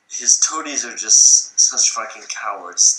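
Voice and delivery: ordinary talk, nothing marked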